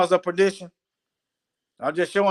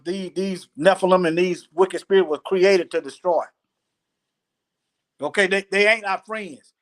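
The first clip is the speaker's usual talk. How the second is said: ordinary delivery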